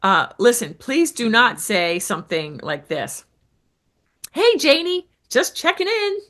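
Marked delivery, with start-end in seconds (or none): 4.3-6.2 breezy, much higher sing-song check-in; lazy check-in. Shown as a demonstration. Coach demos the bad lazy message to a lead, breezy and high. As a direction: toss it off like a lazy, generic follow-up. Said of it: "That's horrible. That's just so lazy".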